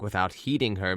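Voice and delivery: usual speaking voice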